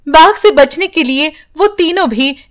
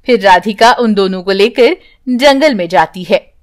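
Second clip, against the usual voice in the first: usual speaking voice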